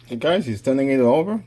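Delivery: usual speaking voice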